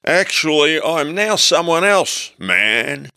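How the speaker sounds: high, slow spaced-out hippie; stoned hippie drawl. A narrator's reading. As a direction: play it dazed and dreamy. Narrator voicing stoned hippie self.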